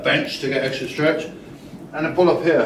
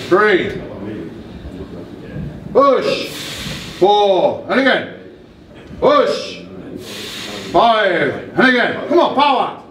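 coach shouting cues; 4 semitones higher, slower than usual. Real, not acted. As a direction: drive the lifter through the rep. Coach cueing a lift.

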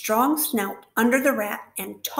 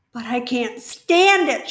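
a loud, higher shout; 3 semitones higher, 8 dB louder than usual. Acted. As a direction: Wilbur protests at the top of his voice. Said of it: "shouted Wilbur".

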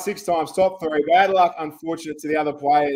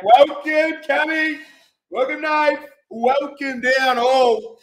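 hype welcome; 7 semitones higher, 3 dB louder, slower than usual. Acted. Host greets viewers joining the stream.

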